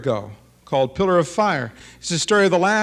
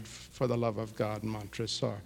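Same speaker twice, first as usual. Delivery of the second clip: far softer, much lower, hollow echo of the cry; faint echo; 6 semitones lower, 14 dB quieter than usual. Acted. The cry heard faintly from inside the closed oven. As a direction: let it fade as if heard from behind the oven. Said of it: "we hear from".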